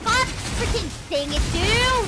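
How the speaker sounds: exasperated yell; frustrated outburst. Real, not acted. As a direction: vent at the chat, rising to a yell on dude.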